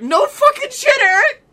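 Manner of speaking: high, loud mock outburst at the driver; exasperated yell. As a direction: blow up at him, half laughing.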